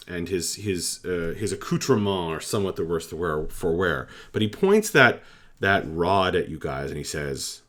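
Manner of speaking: normal talking voice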